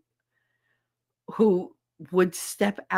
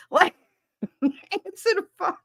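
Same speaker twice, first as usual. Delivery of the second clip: mocking imitation; 13 semitones higher than usual. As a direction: drag it out in a mock high voice. Mocks being told to answer phone.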